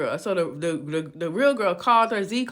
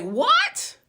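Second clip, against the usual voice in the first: high, louder mock-shocked "What?"; shocked disbelief; 13 semitones higher, 4 dB louder than usual. Storyteller voicing girlfriend who sees her dead boyfriend, high shocked exclamation. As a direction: shoot the voice way up in acted disbelief. Retold shock at seeing him alive.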